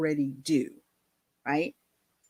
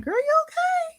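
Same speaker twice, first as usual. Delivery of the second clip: concerned friend. Retold or imitated. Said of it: "the people around me were like".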